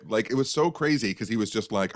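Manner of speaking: ordinary talk, nothing marked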